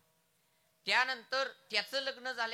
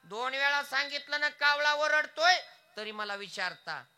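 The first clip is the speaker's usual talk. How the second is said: irritated son; 5 semitones higher, 8 dB louder than usual. Acted. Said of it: "त्या मुलाला थोडा राग आला".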